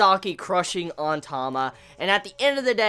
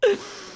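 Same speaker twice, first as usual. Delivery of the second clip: high laugh; 12 semitones higher than usual. Real, not acted. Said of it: "I'm crying right now 'cause it's so funny".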